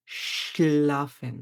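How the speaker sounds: স্লাফেন drawn out very slowly with a sh sound at the start; teacher stretches a German word to show the sh sound; slow model word. Shown as a demonstration. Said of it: "এটার উচ্চারণ হচ্ছে শ এর মতো".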